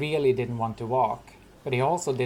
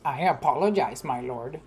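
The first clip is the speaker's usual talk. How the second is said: sing-song mocking apology, pitched up on apologize; a soldier mocking his captain's apology; mocking echo; 2 semitones higher than usual. A narrator's reading. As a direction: mimic the words back with a sneer. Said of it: "came a mocking voice".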